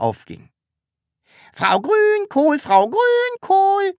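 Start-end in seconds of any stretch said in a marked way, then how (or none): 1.5-3.9 very high, slow, piping little voice; squeaky peep. A narrator's reading. Sams peeps up from under the table, very high. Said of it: "piepste es von da unten".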